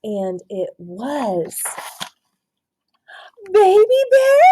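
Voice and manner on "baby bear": put-on high, louder startled "Baby bear?"; surprised question. A narrator's reading. The mommy person recognising the bear, surprised and high. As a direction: recognise an old friend with delight. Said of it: "said the mommy person".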